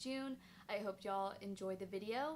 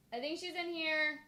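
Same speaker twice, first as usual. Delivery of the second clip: loud, high calling out; 8 semitones higher, 8 dB louder than usual. Real, not acted. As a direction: call out to someone in the next room. Calling to the dog.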